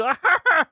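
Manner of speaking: high cackling laugh imitation; big Pee Wee laugh. Shown as a demonstration. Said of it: "The big".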